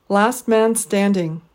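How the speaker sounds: Last, man, stand stressed slowly, ing unstressed; slow Last man standing with a weak final ing; deliberate three-beat Last man standing; three stresses, one weak. Shown as a demonstration. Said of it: "three stressed syllables and one unstressed syllable".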